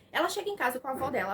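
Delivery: ordinary delivery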